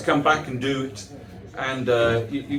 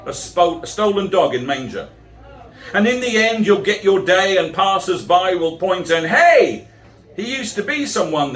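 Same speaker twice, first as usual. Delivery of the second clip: rhythmic, sing-song verse delivery; comic verse read to a crowd; poem recitation; 5 semitones higher, 4 dB louder than usual. Acted. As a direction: recite it like a stand-up poet. Performs a rhyming comic poem.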